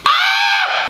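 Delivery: high sharp scream from sourness; pained scream. Real, not acted. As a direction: scream at the sour shock. Extreme sour candy.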